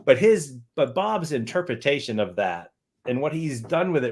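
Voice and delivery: normal talking voice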